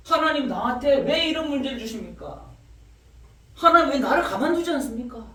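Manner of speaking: high, loud pleading challenge to God; demanding protest. Retold or imitated. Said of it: "막 이렇게 따져요".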